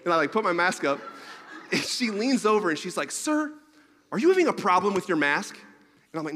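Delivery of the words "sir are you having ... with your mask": "Sir" jumps much higher, then a louder, stern question; mock stern stewardess. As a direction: play a bossy flight attendant catching him out. Stern stewardess scolding passenger.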